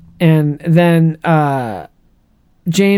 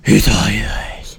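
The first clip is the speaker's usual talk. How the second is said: low, breathy and slow; breathy villain whisper; 8 semitones lower, much slower than usual. Retold or imitated. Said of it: "whenever I do that voice".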